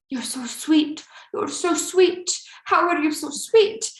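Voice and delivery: high, doting Albanian accent imitation. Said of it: "I'm just trying to do his cute accent".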